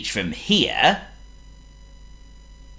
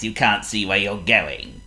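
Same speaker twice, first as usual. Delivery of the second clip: ordinary delivery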